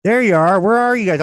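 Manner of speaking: loud, high call into the phone; shouting into a phone. Real, not acted. Host calls out to guests on call.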